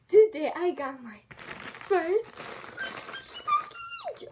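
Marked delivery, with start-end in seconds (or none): 1.9-4.3 much higher, slow, drawn-out squeal; excited squeal. Real, not acted. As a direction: squeal it out, overjoyed. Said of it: "I'm so excited".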